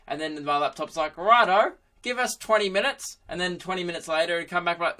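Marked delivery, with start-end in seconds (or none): 1.2-3.2 put-on old man's voice, pitched up; old dude. Laptop stalling its owner, higher old dude voice. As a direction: play an obliging old-timer, voice lifted. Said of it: "like, some old dude taking notes".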